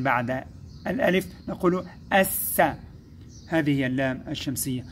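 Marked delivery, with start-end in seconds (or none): 2.1-2.6 الس as A straight into a hissed double S; skipped lam. Shown as a demonstration. Teacher explaining how to read it, skipped lam. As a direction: jump over the L in الس and lengthen the S. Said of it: "نقرأ الألف ونتعدى اللام وننطق السين".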